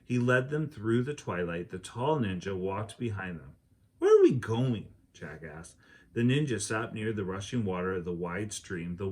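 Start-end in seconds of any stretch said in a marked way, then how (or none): 4.0-4.9 louder anxious question; worried question. Acted. Jack asking the ninjas, louder worried question. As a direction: ask it nervously.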